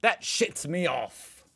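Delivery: trying out Aussie. Pretend. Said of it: "I'm definitely gonna start saying that".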